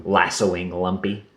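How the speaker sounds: usual speaking voice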